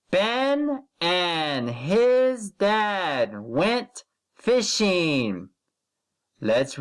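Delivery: slow, drawn-out word-by-word reading; slow model reading. Shown as a demonstration. Teacher slowly modelling a sentence for first graders to repeat. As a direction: stretch each word out for the class. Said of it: "Repeat after me".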